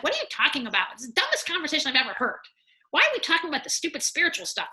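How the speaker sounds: quick, put-on impatient ego voice; dismissive ego. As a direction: play the ego scoffing at spiritual talk. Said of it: "And the ego's like".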